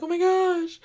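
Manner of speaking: high, loud mock gasp of shock; oh my gosh, high and breathless; retold gasp. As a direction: squeal it like a shocked reader. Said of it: "'cause I'm like".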